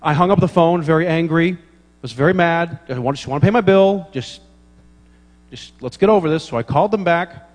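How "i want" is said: usual speaking voice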